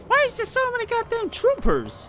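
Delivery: high disbelieving whine; high exasperated squeal. Real, not acted. Gamer overwhelmed by troopers, high exasperated squeal.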